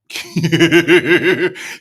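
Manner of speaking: big, loud belly laugh; loud laughter. Real, not acted. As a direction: burst out laughing loudly. Cracks up at the clip.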